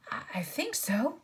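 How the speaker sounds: out of breath; breathless after the fight; panting. A narrator's reading. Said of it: "panted Edmund".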